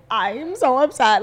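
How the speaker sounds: drawn-out mock-upset quote. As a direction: stretch it out, imitating upset internet commenters. Mocking quote of online commenters.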